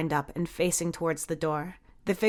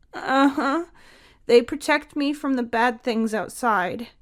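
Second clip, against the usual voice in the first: put-on little boy voice; 6 semitones higher than usual. A narrator's reading. Child voice of Jamie.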